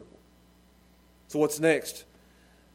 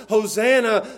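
raised, ringing acclamation; crying out; 6 semitones higher, 8 dB louder than usual. Acted. Crowd greets Jesus at his entry, crying out. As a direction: call it out to a passing king. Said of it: "they start crying out to him".

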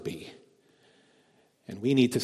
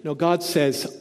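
normal talking voice

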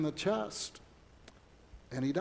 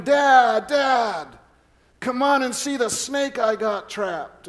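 put-on loud, higher boy's call from across the yard; hollering; 6 semitones higher, 7 dB louder, slower than usual. Son calling his dad to see a snake, loud and high. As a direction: shout for Dad to come see. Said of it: "then I hear him hollering".

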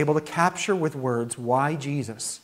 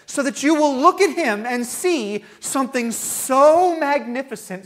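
high, loud building preacher cry; rising sermon crescendo; preaching at full force; 9 semitones higher, 5 dB louder than usual. Acted. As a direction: build it up, louder and higher, to the climax.

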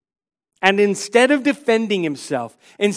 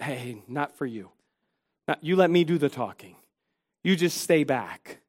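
hushed aside; 6 semitones lower, 9 dB quieter than usual. Retold or imitated. Voicing Sanballat hushing Tobiah.

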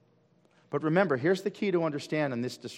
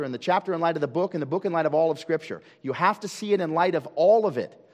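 urgent teaching; 5 semitones higher, 3 dB louder than usual. Acted. Urging listeners to study scripture.

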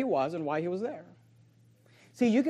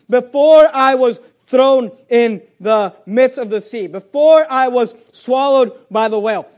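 loud, slow, punched scripture quotation; full-force preaching; 4 semitones higher, 13 dB louder, slower than usual. Real, not acted. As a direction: preach it out loud, drawing out each phrase.

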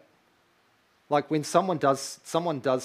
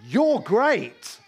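higher, louder proclamation; booming declaration; 7 semitones higher, 7 dB louder than usual. Retold or imitated. Pastor voicing God praising the child, booming. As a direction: ring it out as God's praise. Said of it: "how can God say of another being".

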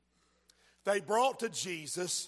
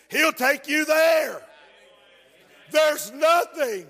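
loud, rising sermon shouts; preaching at full force; 5 semitones higher, 8 dB louder than usual. Acted. Preacher shouting nothing is impossible to congregation. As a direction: preach it hard to the crowd.

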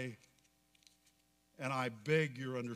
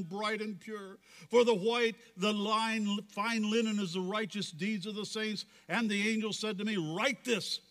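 raised, projected preacher's reading; fervent scripture recitation; 6 semitones higher, 4 dB louder than usual. Acted. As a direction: declaim the scripture to a whole congregation. Preacher recites scripture at full force.

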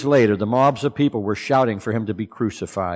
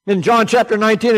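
usual speaking voice

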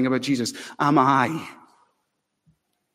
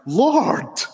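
astonished Peter; 10 semitones higher than usual. Retold or imitated. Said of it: "And Peter says".

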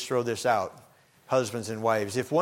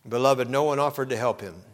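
ordinary talk

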